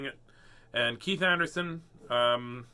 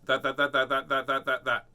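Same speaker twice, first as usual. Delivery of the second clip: rapid-fire repetition. Shown as a demonstration. Reading to kids, tries a tongue twister fast. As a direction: rattle off that as fast as possible. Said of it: "Was that the fastest you could go?".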